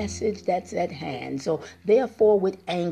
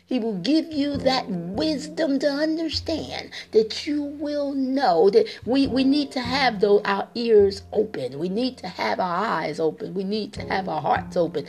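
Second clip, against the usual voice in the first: fervent preaching; 3 semitones higher than usual. Acted. Preacher pleading with listeners to open their eyes, fervent. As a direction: lean on every promise.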